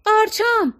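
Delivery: high, narrated squeal; shrieked alarm. A narrator's reading. Dori alarmed for the mushrooms, shrieking. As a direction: cry out high in dismay. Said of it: "دوری جیغ زد".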